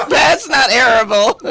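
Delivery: said through laughter; laughing. Real, not acted. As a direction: giggle it out. Laughs repeating her joke.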